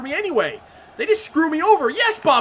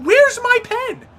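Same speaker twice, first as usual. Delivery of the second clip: much higher, slow, louder outraged cry; stretched-out high protest; exasperated plea; 11 semitones higher, 4 dB louder, slower than usual. Real, not acted. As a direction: plead with the ref in disbelief. Denied a penalty again.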